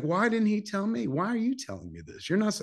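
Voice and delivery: high, indignant protest; retelling his own hurt surprise at the news; worked-up disbelief. Retold or imitated. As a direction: voice climbing in hurt confusion. Retelling his own shocked reaction.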